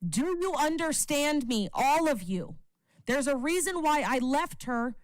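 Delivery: high, heated rant at the viewers; furious yelling. Real, not acted. Streamer ranting angrily at viewers about the heartbreak. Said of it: "Would you like me to be fucking louder".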